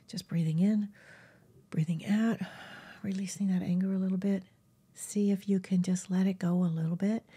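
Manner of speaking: soft whispered breathing guide; guided meditation whisper. Acted. Guiding viewers to breathe out anger, whispered. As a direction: ease them into releasing anger in a whisper.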